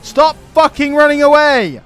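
loud, furious ranting at fleeing enemies; angry rant. Real, not acted. Gamer ranting at retreating AI armies, real furious shouting. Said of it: "I wasn't expecting to get such a rant in today".

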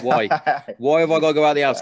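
hammed-up complaint at being sent out; mock whiny protest.

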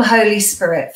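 normal talking voice

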